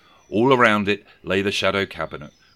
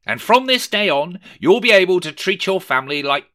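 grand; 11 semitones higher, 7 dB louder than usual. Acted. Scrooge voiced.

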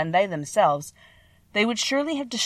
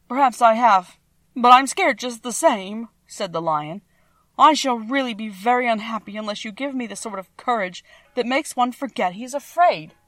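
Lion's voice; 4 semitones higher, 5 dB louder than usual. Acted. Lion voiced by the narrator.

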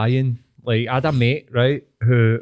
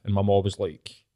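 ordinary delivery

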